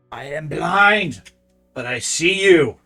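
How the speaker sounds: loud, hissing creature voice. Acted. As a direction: hiss out an ancient blind oracle. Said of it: "to speak out in a hissing voice".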